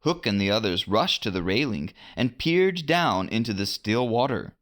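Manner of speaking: plain reading voice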